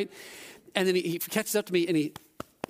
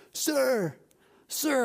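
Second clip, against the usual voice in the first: high, drawn-out call after someone; 8 semitones higher than usual. Retold or imitated. Salesman calls after a departing tourist, calling out. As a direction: imitate a salesman hailing from afar. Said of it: "I hear him call out".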